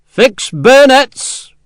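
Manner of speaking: loud, high, very slow drawn-out command; growled order. A narrator's reading. Officer ordering his sepoys to fix bayonets, drawn-out shout. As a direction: stretch the order out over the whole company. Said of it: "growled an order to his company".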